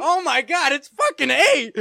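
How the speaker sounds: high, loud exclamation of real disbelief. Real, not acted. Quiz host exclaims as eight comes up, loud disbelief. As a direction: blurt it out, high and loud, drawn out at the end.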